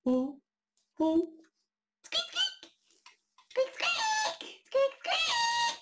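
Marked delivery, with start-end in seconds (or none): 2.0-5.8 high squeaky mouse, building to a laugh; mouse squeaks. Acted. Imitating a mouse.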